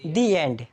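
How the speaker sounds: "the end" as "dee end". Shown as a demonstration. Teacher repeats the correction, "the end" as dee end. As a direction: say "the" with long ee before "end". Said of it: "क्या?".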